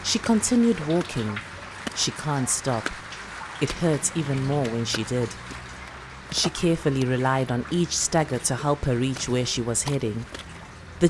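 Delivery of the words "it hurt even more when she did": plain reading voice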